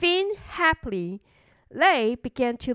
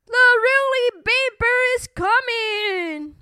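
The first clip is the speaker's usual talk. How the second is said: calling out; 10 semitones higher than usual. A narrator's reading. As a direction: herald the danger in a raised voice. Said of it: "they called".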